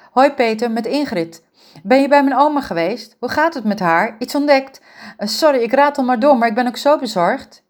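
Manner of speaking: hoger, snel doorratelend en bezorgd; bezorgde jonge vrouw. Acted. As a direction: een jonge verzorgster die zich zorgen maakt om haar oma. Ingrid belt bezorgd met Peter. (bezorgde Ingrid, hoger en luider pratend)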